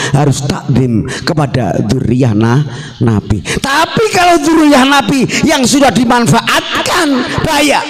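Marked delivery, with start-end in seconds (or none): 3.6-7.8 high, forceful preacher's warning; impassioned warning. Real, not acted. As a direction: sound the alarm to the crowd. Warning the crowd in sermon.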